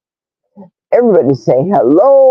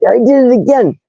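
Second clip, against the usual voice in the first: ordinary talk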